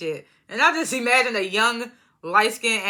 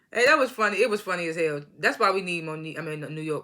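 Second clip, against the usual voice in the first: ordinary delivery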